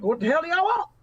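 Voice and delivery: retold doorstep challenge from an old farmer. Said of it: "this old Farmer John looking dude opens the door".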